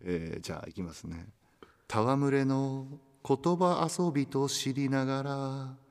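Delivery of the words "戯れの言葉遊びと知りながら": slow, measured poem reading; poem recitation. Acted. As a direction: read it like a recited verse. Reads a listener's tanka aloud. (ゆっくり朗読する詩)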